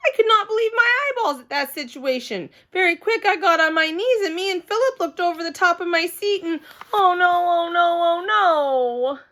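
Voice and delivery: put-on high, loud little girl in alarm; a panicked six-year-old narrator; Junie B kid voice. Girl narrating to listeners, high and alarmed.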